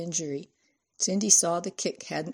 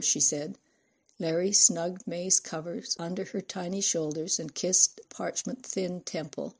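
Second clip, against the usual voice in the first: ordinary delivery, nothing marked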